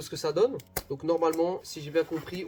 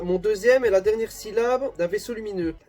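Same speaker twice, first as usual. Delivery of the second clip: loud, higher-pitched riddle reading; reading aloud; 5 semitones higher, 6 dB louder than usual. Real, not acted. Reading the riddle card to viewers, loud read-aloud. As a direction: read the clue out with force.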